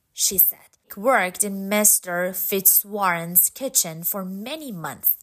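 plain reading voice

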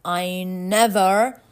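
a touch of baffled surprise; puzzled amazement. A narrator's reading. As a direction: draw it out in wonder. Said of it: "scratching his head puzzled".

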